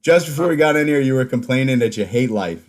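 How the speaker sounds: ordinary delivery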